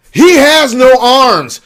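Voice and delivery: high, loud, drawn-out yell; slow incredulous shout; exasperated outburst. Real, not acted. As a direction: stretch each word in loud disbelief. Exasperated outburst at absurd demo.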